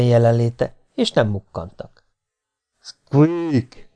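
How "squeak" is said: oafish, long-drawn squeak; clumsy bellowed squeak. A narrator's reading. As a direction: botch the animal call with a long bellow. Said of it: "bődült el az ifjonc".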